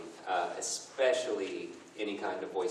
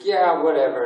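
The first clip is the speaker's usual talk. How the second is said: dismissive snap, higher and much louder; mock mad; 7 semitones higher, 11 dB louder than usual. Boyfriend feigning anger at his girlfriend, mock-mad brush-off. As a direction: act huffy and brush them off loudly. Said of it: "trying to act all mad".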